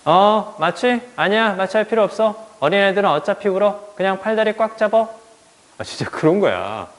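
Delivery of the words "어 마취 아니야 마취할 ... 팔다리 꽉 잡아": high, offhand doctor's voice; lightly voiced doctor, higher pitched, waving it off; dismissive doctor. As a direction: brush off the question like a careless doctor. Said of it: "의사 선생님이 뭐라고 그랬냐면".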